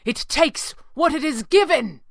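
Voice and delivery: high, emphatic call; crying out. A narrator's reading. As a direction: proclaim it urgently over the battle. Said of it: "the red panda cried".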